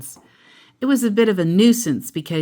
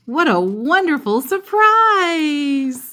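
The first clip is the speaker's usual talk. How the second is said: delighted; 9 semitones higher, 4 dB louder, slower than usual. A narrator's reading. Narrator reads Sister's delight.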